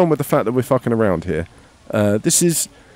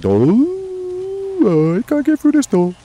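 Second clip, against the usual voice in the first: much higher, whiny put-on voice, mocking; whiny mock prisoner; much slower than usual. Mocking the prisoners stuck at a door in a whiny voice. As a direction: whine it like a helpless little prisoner. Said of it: "watching you guys stand there going".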